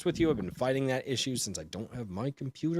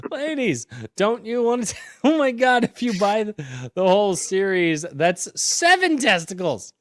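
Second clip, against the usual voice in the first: hyped pitch; 9 semitones higher, 7 dB louder than usual. Pretend. Joking sales pitch to ladies.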